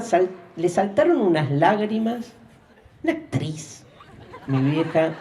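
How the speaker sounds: ordinary talk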